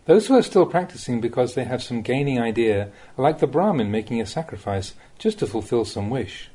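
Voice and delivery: usual speaking voice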